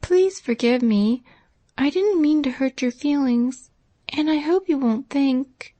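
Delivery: put-on a girl afraid she hurt a friend; timid faltering plea. A narrator's reading. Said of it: "she faltered presently".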